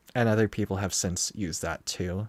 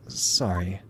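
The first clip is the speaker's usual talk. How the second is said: ordinary delivery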